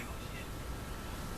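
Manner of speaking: whispered shocked reaction. Retold or imitated. Onlookers reacting.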